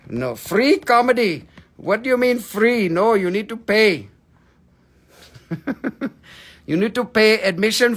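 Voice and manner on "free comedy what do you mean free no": usual speaking voice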